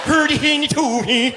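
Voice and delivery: high, slow singsong gibberish chef; high sung mock chef babble; Swedish Chef. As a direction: sing it out like a silly Muppet cook. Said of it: "the Swedish chef from the Muppets".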